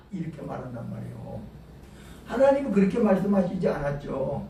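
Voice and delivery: ordinary delivery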